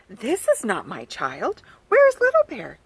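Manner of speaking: mother bear panics for her cub; alarmed mother bear. Acted. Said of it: "she cried".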